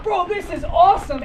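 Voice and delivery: high, loud retold enthusiasm; exultant. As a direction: crow it to your pacer mid-run. Runner exulting at pacer's question.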